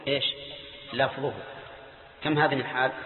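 ordinary delivery

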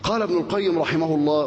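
usual speaking voice

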